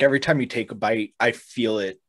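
normal talking voice

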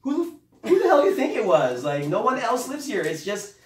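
high, loud, mock-incredulous rant; dad shouting at mom over who walked in, high mock rant; exasperated dad. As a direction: play the dad ranting in disbelief at the question. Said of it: "all I hear from my dad is".

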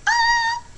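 high, loud squeal of excitement; excited squeal. Acted. Vlogger telling viewers about the snow forecast, excited squeal. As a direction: squeal like you can't contain it. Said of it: "I'm so excited".

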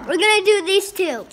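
loud insisting. Real, not acted. Child insisting loudly.

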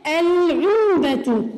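العلبة very slow with a clear lam; stretched العلبة with the l voiced; slow moon-letter demo. Shown as a demonstration. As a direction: draw out العلبة as al-ʕulba. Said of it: "اللام تلفظ هنا".

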